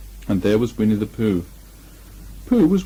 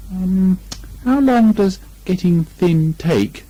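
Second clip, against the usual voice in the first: anxious; 7 semitones higher, 4 dB louder, slower than usual. A narrator's reading. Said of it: "asked Pooh, rather anxiously".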